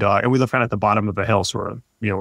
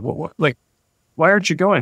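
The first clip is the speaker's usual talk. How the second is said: puzzled; 6 semitones higher than usual. Retold or imitated. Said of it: "I'm like".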